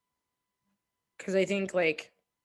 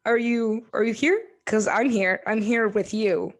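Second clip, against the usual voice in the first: higher, louder imitation of partner checking in; imitating partner; 4 semitones higher, 7 dB louder than usual. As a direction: pull the manic person back into the room. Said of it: "she'd be like".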